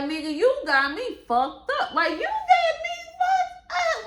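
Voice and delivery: high, loud indignant repeat; hammed-up fury at him; mock angry text. Retelling her angry texts.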